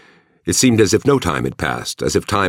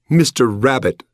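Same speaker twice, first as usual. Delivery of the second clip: mocking; 9 semitones higher than usual. A narrator's reading. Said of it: "the girl said mockingly".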